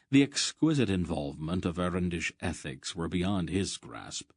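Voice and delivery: ordinary delivery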